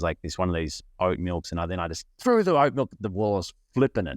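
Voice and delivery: ordinary talk, nothing marked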